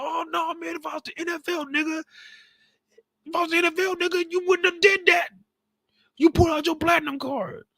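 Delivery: high, loud whining complaint; mock whiny friend. Friend Jazz complaining about DeMarcus in a loud, high whine. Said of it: "He's like".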